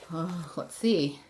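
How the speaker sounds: plain reading voice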